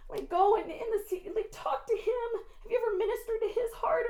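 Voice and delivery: usual speaking voice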